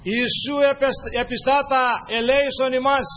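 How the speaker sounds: loud, high pleading cry; the ten lepers crying to Jesus, loud and high; crying for mercy. Acted.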